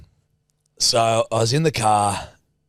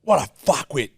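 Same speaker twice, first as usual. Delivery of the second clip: retold screaming; 6 semitones higher than usual. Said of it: "Just screaming that to myself".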